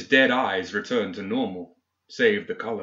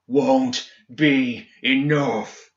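slow, drawn-out character roar; 2 semitones higher, much slower than usual. A narrator's reading. Seamus defying the entity consuming him, roaring slowly. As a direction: roar it out word by heavy word. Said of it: "he roared".

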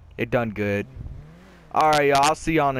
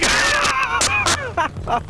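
very high, long burst of laughter; gleeful laugh. Real, not acted. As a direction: cackle high at a rival's misfortune. Laughs at a rival's crash.

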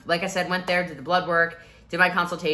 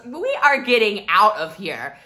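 louder, decisive; firm exit; 5 semitones higher, 8 dB louder than usual. Retold or imitated. Friend announcing they leave, loud and firm. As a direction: announce leaving. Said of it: "And Courtney goes".

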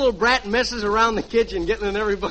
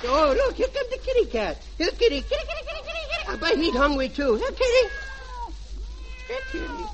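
very high, soft squeaky kitty call; 13 semitones higher, 4 dB quieter than usual. Acted. Junior calling the kitty cat, high squeaky coo.

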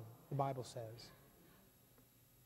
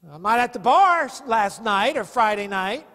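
louder, defensive protest; self-righteous excuse; 3 semitones higher, 14 dB louder than usual. Retold or imitated. Imagined churchgoer excusing himself, loud defensive protest. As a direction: defend yourself smugly.

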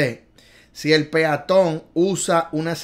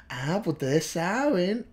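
normal talking voice

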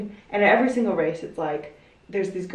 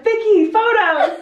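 higher, louder, slow call from the side; 14 semitones higher, 7 dB louder, slower than usual. Retold or imitated. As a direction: shout her name across the course. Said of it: "kept asking me".

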